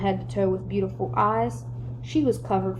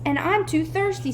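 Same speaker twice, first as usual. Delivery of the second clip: whining; 7 semitones higher than usual. A narrator's reading. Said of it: "Dana whined".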